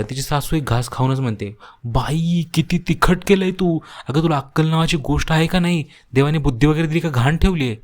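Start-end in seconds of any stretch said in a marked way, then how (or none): 1.8-7.8 higher-pitched indignant scolding; exasperated, high-pitched telling-off; scolding mother-in-law. A narrator's reading. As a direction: scold her in a raised pitch. Mother-in-law scolding over spicy food.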